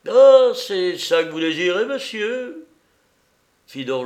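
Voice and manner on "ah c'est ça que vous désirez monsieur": mocking courtesy. Don Louis mocks the bandit.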